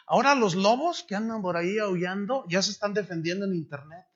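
ordinary delivery

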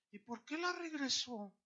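lightly voiced puzzled mother; puzzled questioning. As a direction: imitate a woman who can't quite understand.

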